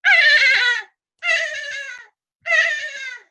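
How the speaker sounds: high, piercing squawk done as a bird; imitated bird screech. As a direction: caw like a shrill bird overhead. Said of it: "it's going".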